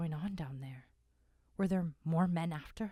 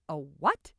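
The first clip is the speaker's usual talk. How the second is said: startled; 11 dB louder than usual. Acted. Startled listener.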